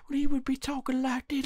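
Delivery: put-on high, creepy old-man voice; creepy old guy. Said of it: "the really creepy old guy from Family Guy".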